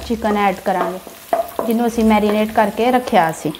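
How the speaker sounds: usual speaking voice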